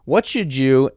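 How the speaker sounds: words around a sip of tea; sipping tea. Real, not acted. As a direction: talk between sips of hot tea. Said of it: "I'm drinking tea".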